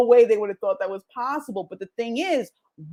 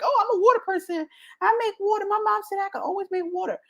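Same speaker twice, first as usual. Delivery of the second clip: much higher, quick, sing-song mimicry; mock childish boaster; 10 semitones higher, faster than usual. Mocking someone who only heard it from mom, high boastful kid voice. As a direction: parody a proud kid quoting mom, high and fast.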